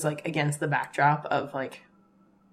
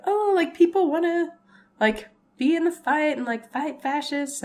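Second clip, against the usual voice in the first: excited; 10 semitones higher than usual. Retold or imitated. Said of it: "I was like".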